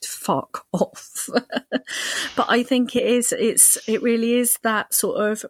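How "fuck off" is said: soft muttered brush-off; half-laughing, fed-up dismissal; quietly muttered irritation; irritated. Retold or imitated. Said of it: "I was just so irritated by it".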